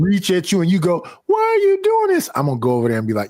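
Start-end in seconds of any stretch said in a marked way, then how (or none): 1.2-2.3 much higher mock plea; imitating a scared woman begging her attacker; helpless woman. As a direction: pitch way up like a frightened woman pleading.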